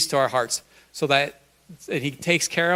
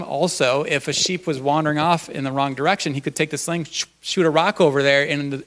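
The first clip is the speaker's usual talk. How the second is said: usual speaking voice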